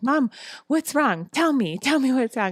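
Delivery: put-on high little-boy voice, concerned; son's worried voice. Mother voices her son asking why she's crying, high and concerned. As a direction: voice a worried four-year-old asking what's wrong.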